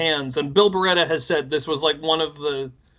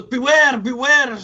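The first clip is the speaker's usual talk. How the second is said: spooky warning quote; 8 semitones higher than usual. Retold or imitated. Quoting the film's eerie warning to his cohost in a much higher, hammy voice. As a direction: ham up a ghostly prophet.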